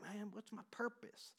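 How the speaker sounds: quiet Southern-accented mock complaint. Preacher voicing people searching for purpose, in a Southern accent. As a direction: lightly put on a Southern accent, softly. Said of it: "I go to a Southern accent".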